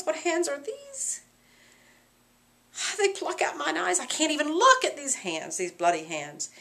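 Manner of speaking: stare at your hands; horrified Macbeth. Acted.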